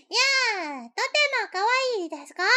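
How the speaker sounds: high cute voice with long vowels; put-on high kawaii speech; ultra kawaii voice. Shown as a demonstration. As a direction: squeak it out sweet and cute. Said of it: "the ultra kawaii voice?".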